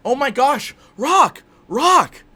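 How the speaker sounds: high, loud cries for help; hammed-up alarm. Heroine calling to two men named Rock, hammed-up shouting. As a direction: shout the name in mock panic. Acted panic in invented scene.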